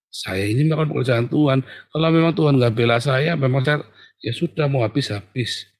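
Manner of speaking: ordinary talk, nothing marked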